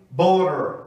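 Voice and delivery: butter with a tapped middle, said bu-rer; flapped T demo. Shown as a demonstration. Teacher demonstrating the flap in butter. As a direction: say butter the American way, the T a quick tap. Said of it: "O D também passa a ter som de R".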